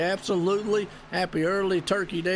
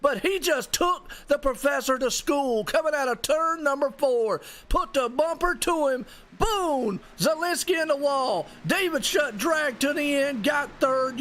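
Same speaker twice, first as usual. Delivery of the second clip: excited commentary; 7 semitones higher than usual. Real, not acted. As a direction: relive the wreck, fired up. Replay of the finish.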